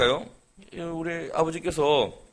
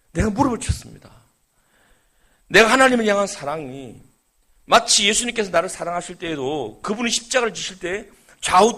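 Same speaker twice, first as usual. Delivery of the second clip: higher, louder preaching climax; fervent preaching; 4 semitones higher, 4 dB louder than usual. Acted. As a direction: drive the sermon point home with fire. Preaching at full force.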